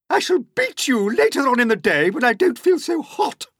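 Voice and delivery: high, loud cruel aunt; Aunt Spiker threatening. Acted. Aunt Spiker threatening James, high and loud. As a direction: promise the beating with relish. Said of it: "she said".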